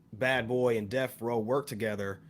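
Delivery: ordinary delivery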